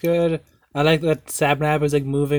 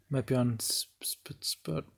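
whisper; 12 dB quieter, slower than usual. Real, not acted. Joking aside about podcast platforms.

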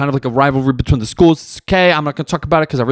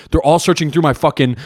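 usual speaking voice

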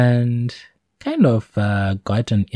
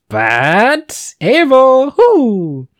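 excited whoop; 4 dB louder, much slower than usual. Retold or imitated. God delighted by Abel's offering.

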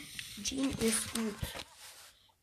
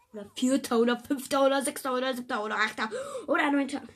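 rattled list; 2 semitones higher, 6 dB louder, faster than usual. Real, not acted. Rattling off rankings.

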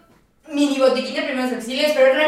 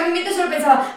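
normal talking voice

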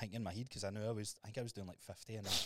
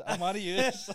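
higher, louder put-on voice; mock voice; 9 semitones higher, 10 dB louder, slower than usual. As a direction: ham up the claim in a put-on voice. Joking mock accent claim.

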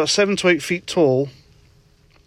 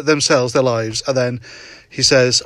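ordinary talk

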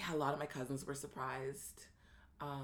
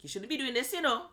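higher, louder mock-scolding mother voice; mock scolding mom; 10 semitones higher, 5 dB louder than usual. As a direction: play the old-school mom tutting at her daughter. Mom's disapproving backhanded warning.

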